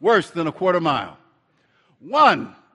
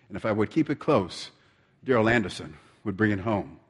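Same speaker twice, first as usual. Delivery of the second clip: solemn preaching; 10 semitones lower, 3 dB quieter than usual. Real, not acted. Preacher drawing the lesson for brothers and sisters, solemn. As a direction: bring it down low and grave, with pauses. Turns race into solemn message.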